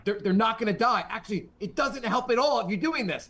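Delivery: high-pitched, hammed-up objection; mock exasperated protest. Exasperated onlooker arguing with the gym hero, mock-dramatic. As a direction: argue back in a strained high voice.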